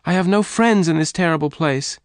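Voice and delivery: high, loud pleading; crying out. A narrator's reading. Jane pleading with Lassiter, crying out. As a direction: plead against the killing. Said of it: "Jane cried".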